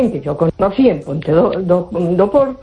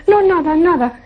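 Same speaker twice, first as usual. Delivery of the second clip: high-pitched imitated brush-off; dismissive denial; 9 semitones higher than usual. Card reader hiding what she saw, dismissive. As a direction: wave the worry away in a higher voice. Fortune-teller hastily covers her shock.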